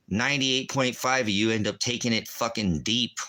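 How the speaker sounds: usual speaking voice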